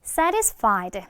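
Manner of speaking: "satisfied" as SA-ris-fied, slowly modelled; t weakened to r. Shown as a demonstration. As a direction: turn the t in "satisfied" into a light r. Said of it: "r로 많이 약화가 되거든".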